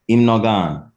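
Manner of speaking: HIM NO GONE level, dropping slightly on GONE; statement intonation. Shown as a demonstration. Teacher showing "he has not left", falling statement intonation. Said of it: "One means he has not left".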